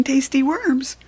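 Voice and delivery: plain reading voice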